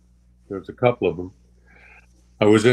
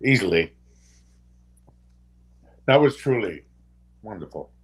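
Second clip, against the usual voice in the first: getting emotional; 2 semitones higher, 7 dB louder than usual. Real, not acted. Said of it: "I get emotional".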